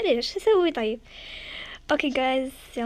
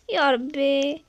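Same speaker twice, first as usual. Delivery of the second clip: ordinary talk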